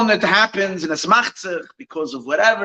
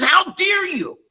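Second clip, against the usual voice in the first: mock yelling; 13 semitones higher, slower than usual. Irate boss confronts the teacher living with him, mock yelling. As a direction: play the outraged accuser, pitch jumping up. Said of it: "He starts yelling at the teacher".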